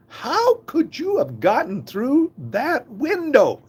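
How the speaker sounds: high, slow, disbelieving question; astonished dad. Retold or imitated. Father to daughter who snuck out, stunned and high. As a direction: lift way up in sheer disbelief.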